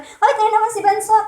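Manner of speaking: high teasing call to a classmate; a hint of a schoolyard bully jeering; mock bully taunt. A bully teasing a short kid at school, high mock taunt.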